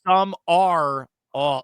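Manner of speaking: slow, separated "Some are all"; syllable-by-syllable pronunciation. Shown as a demonstration. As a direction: break "Some are all" into three drawn-out pieces. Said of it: "okay, so I learned this".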